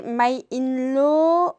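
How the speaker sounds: careful, slow English from a French speaker; French-accented English title. Real, not acted. Reading an English webtoon title to viewers, slow French-accented English. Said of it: "Excusez-moi pour mon accent anglais".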